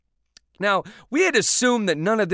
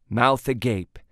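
ordinary delivery

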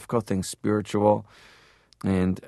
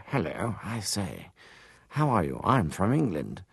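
put-on posh English accent; 5 dB quieter than usual. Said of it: "Most actors were like".

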